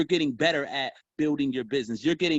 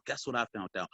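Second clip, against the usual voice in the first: low secretive whisper; 3 semitones lower, 8 dB quieter, faster than usual. Shown as a demonstration. Salesman to a prospect on the phone, hushed secret voice. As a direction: drop the voice down low, like sharing a secret. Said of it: "I'll take my voice down real low".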